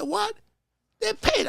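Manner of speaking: high-pitched mock disbelief; retold astonishment. Agent retelling news of the payout, astonished.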